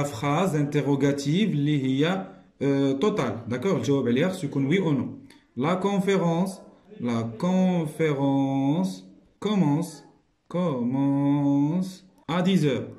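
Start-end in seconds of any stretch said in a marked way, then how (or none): 5.6-12.9 slow, word by word; slow dictation. Shown as a demonstration. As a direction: dictate the answer slowly. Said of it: "dictating answer".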